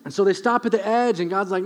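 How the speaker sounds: usual speaking voice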